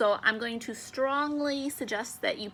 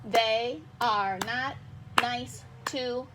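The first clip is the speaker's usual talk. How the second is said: very slow, a gap after each word; syllable by syllable; much slower than usual. Shown as a demonstration. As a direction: read it out beat by beat for counting. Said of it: "I'm gonna check and make sure".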